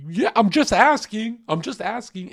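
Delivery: a caught-out guy protesting loudly; defensive. Retold or imitated. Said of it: "he gets very defensive".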